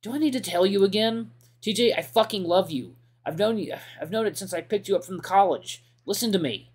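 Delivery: heated plea. Acted.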